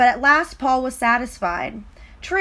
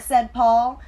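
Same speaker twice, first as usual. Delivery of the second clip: usual speaking voice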